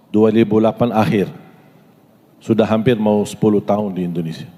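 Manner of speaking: normal talking voice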